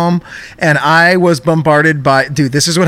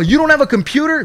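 much higher, lecturing like a stern father; scolding dad; 10 semitones higher, faster than usual. Retold or imitated. Agent lecturing the caller like a father. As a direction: talk down to him like a dad. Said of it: "Like, he's my dad".

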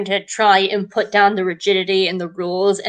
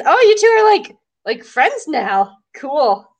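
mock surprise; 6 semitones higher, 4 dB louder, slower than usual. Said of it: "come back and be like".

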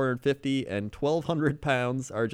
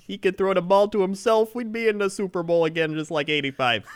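loud, higher Chicago fan bluster; Chicago superfan; 6 semitones higher, 5 dB louder than usual. Retold or imitated. Chicago fan dreaming of Ditka, loud Chicago accent. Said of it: "you talking like you're from Chicago".

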